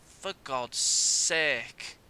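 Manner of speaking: very slow, drawn-out exasperated groan. Real, not acted. Gamer groaning slowly after being caught on the counter. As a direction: drag it out in weary despair. Conceding again.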